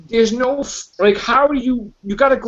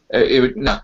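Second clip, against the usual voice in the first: ordinary talk, nothing marked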